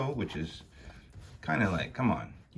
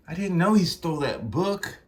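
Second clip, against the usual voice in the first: higher-pitched startled protest; defensive protest; 8 semitones higher than usual. Acted. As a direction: protest innocence in a high voice. First take, broken off by mistake.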